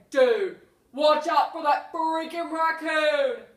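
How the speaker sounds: loud, slow warning yell; alarmed shout. Pretend. Coworker on a call warning Victor, shouting.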